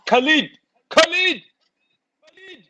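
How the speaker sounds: Khalid! called out high and loud, rising each time; calling out. Pretend.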